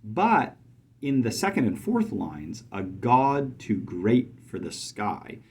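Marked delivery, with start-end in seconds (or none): 2.7-5.4 slow, each iamb marked; slow down and hit every other beat; iambs stressed. Shown as a demonstration. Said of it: "That's iamb, iamb, anapes".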